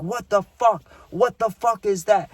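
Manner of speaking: high, alarmed imitation of a friend; imitated yelling. Friend who just stepped on something on the stairs, alarmed mock yelling.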